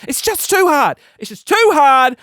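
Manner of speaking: high, emphatic complaint; whining. Real, not acted. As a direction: protest it like giving up. Complaining the diet is hard.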